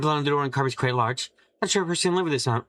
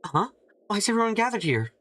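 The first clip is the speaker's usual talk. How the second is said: puzzled voice; 8 semitones higher than usual. Acted. Character arrives, puzzled.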